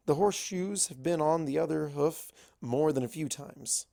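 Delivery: usual speaking voice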